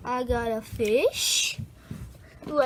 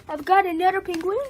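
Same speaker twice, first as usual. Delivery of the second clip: quick, higher, louder burst; excited announcement; 5 semitones higher, 5 dB louder, faster than usual. Real, not acted. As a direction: blurt it out thrilled with the new toy. Showing toys one by one.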